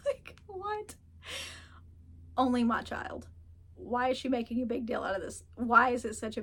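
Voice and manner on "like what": real high-pitched laughing burst into a bewildered what; laughing in disbelief at the daughter's ow. Real, not acted. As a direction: crack up, then ask what.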